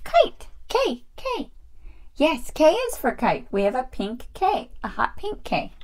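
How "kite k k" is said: very high doll voice; Peach. Pretend. Doll answering the teacher, high-voiced pupil. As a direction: pipe up the answer in a tiny toy voice.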